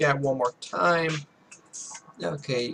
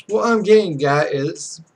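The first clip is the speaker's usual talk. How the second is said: normal talking voice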